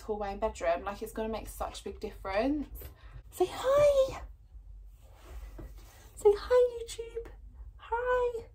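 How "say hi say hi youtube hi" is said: very high sing-song coo to a little one. Real, not acted. Mum coaxing baby to greet YouTube, high cooing baby talk.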